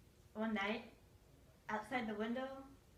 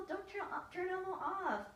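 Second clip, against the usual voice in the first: put-on higher, tearful child's voice; crying little kid pleading; crying plea; 9 semitones higher than usual. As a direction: beg through tears, pitched up. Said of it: "little brother started crying".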